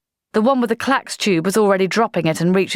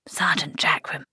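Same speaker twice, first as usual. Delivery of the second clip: unvoiced whisper; officer whispering to his sergeant in hiding; 6 dB quieter than usual. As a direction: breathe the name. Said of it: "whispered Blouse".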